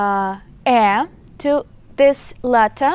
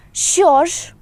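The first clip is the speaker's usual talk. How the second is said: Що ж said higher and louder, questioning; emphatic що ж; 7 semitones higher, 5 dB louder than usual. Shown as a demonstration. Teacher demonstrating an emotional Що ж to students, louder and higher. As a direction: lift Що ж up in a loud, emphatic ask. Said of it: "how we can translate it".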